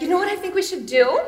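playful mom pitching a family plan; mom's voice. Retold or imitated. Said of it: "This is my mom".

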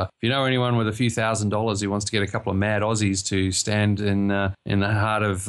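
ordinary delivery

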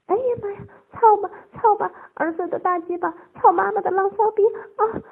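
high-pitched crying exclamations; worked-up wailing encouragement; eagerly responsive. A narrator's reading. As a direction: answer in high, excited cries. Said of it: "不时地迎合着说".

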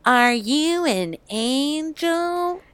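slow, lightly swooning British-free Anakin; mock Anakin line. Mocking Anakin's line to Padme, slow and swooning. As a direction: drawl it like a lovesick boy. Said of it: "Anakin saying to Padme".

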